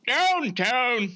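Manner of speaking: high, loud announcer fish; news anchor fish voice. Acted. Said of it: "the news fish".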